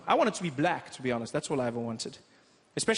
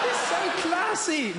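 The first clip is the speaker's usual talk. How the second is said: mock posh; 14 semitones higher, 3 dB louder, slower than usual. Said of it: "No, he's a pedophile".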